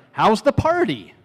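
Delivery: high, drawn-out friendly question; acted text message. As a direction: ask it like a breezy texter, slow and high. Reads out an example text.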